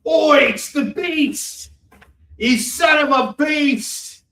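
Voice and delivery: slow, loud, high Australian shout; Australian accent. Pretend. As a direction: ham up a Russell Crowe Australian roar. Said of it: "pulling out my Australian accent".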